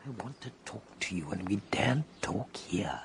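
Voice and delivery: urgent, secretive whisper. Acted. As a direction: whisper quickly so nobody hears. Doesn't dare talk openly here.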